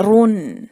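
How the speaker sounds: رن with a thick heavy ر. Shown as a demonstration. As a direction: make رن full and heavy. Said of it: "آپ نے بھاری کرنا ہے". (رن بھاری اور واضح)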